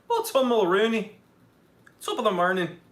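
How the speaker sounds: playful stage Irish greeting; Irish accent. Pretend. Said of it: "I just do accents of wherever you're from".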